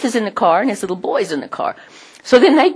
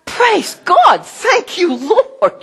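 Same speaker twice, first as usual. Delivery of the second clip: high, bright burst of thanks; joyful praise; 11 semitones higher, much slower than usual. Real, not acted. Preacher rejoicing to his congregation, high and joyful. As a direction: lift it up in thanks. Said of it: "preacher rejoicing".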